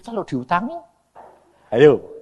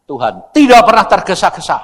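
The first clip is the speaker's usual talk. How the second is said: normal talking voice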